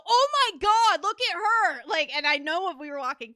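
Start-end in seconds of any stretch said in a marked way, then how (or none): 0.1-1.8 loud, high imitation of an amazed fan; retold cry of excited awe; gushing onlooker. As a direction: exclaim it like a starstruck passerby. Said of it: "I did hear someone go".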